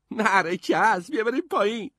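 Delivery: put-on high, loud, gleeful kid voice; rally your brother to go down; excited boy. A narrator's reading. Terry claps, urges brother down.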